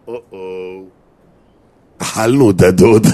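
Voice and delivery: low uh-oh, then a loud slow groan of realization; mock dawning dread, drawn out; talking to himself. Husband realizing his mistake, talking to himself. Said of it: "הוא אומר לעצמו".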